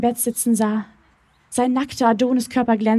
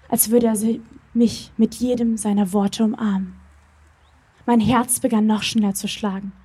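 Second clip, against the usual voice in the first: plain reading voice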